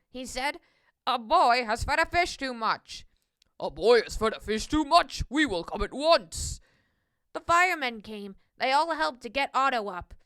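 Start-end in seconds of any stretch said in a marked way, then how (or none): 1.1-3.1 policeman on the radio. A narrator's reading. 3.6-6.6 low, loud firemen's voice; deep, forceful emergency reply; firemen responding. A narrator's reading.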